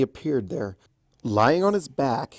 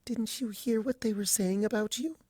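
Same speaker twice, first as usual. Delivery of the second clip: much higher, soft, pleading; desperate; 10 semitones higher, 7 dB quieter than usual. A narrator's reading. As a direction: plead for him to understand. Pleading, distressed question.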